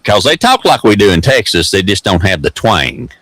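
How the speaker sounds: ordinary talk, nothing marked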